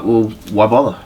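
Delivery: normal talking voice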